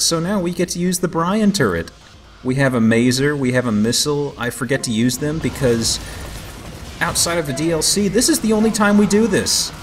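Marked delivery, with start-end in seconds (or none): none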